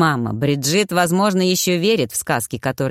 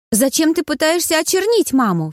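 put-on much higher, indignant girl's voice; Bridget defending; 11 semitones higher than usual. A narrator's reading. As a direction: stand up for mother, high and upset. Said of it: "вступилась Бриджит". (высокий девичий голос, заступается)

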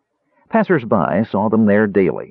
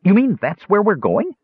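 high, amazed kender question; thrilled, high kender voice; wide-eyed excitement; 12 semitones higher than usual. A narrator's reading. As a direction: ask in high-pitched wonder. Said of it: "his eyes wide".

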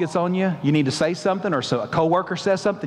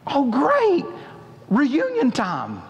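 sarcastic Jacob; 5 semitones higher, much slower than usual. Acted. Jacob reacts to news sarcastically.

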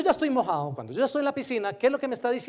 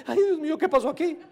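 high, loud, laughing mock panic at the crocodiles; 8 semitones higher, 5 dB louder than usual. Swimmer spotting crocodiles in the pool, hammed-up laughing panic. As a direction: yelp it in hammed-up alarm with a laugh.

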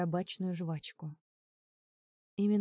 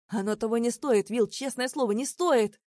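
loud and out of breath; panting; 4 semitones higher, 8 dB louder than usual. A narrator's reading. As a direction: puff it out while holding him. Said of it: "пропыхтел он".

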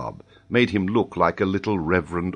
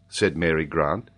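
ordinary delivery

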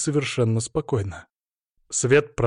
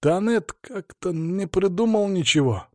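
higher, hesitant embarrassed reply; a touch of embarrassment, pitched up; 6 semitones higher, slower than usual. A narrator's reading. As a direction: admit it sheepishly, dragging the words a little. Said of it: "заметно смутившись".

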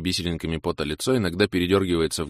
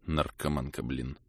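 muttered aside; 4 semitones lower, 9 dB quieter, slower than usual. A narrator's reading. Grumbling aside to himself.

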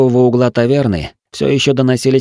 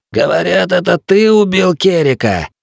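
higher, hollow croaking villain; croaking raven voice; 9 semitones higher than usual. A narrator's reading. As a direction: caw the words like a raven. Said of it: "глухим и каркающим, будто у ворона".

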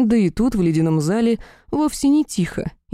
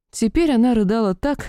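plain reading voice

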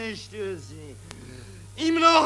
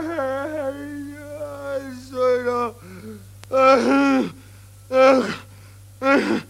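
ordinary talk

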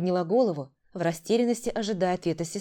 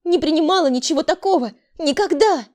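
high, loud cry of denial; sudden shout; 9 semitones higher, 8 dB louder than usual. A narrator's reading. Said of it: "Вдруг закричала она". (внезапный крик)

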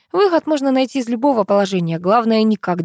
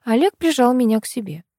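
plain reading voice

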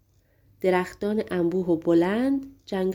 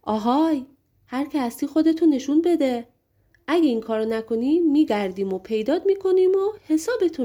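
higher-pitched storybook shout; calling out; 6 semitones higher than usual. A narrator's reading. Giant calls to the hidden shooter, raised storytelling shout. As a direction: call out a threat to someone hidden, voice raised in pitch. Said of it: "صداشو بلند کرد و فریاد زد".

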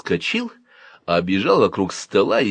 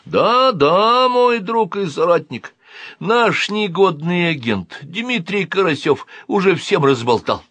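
high, loud pompous officer; alien major; 9 semitones higher, 3 dB louder, slower than usual. A narrator's reading. Alien answers the boy, high pompous voice. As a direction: declaim to a new comrade.

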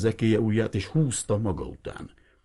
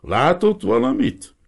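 put-on old fisherman's question; 7 dB louder, slower than usual. Old fisherman asks the priest.

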